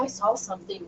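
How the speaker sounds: lightly hushed, as if telling a secret; retold whisper. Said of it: "I whispered".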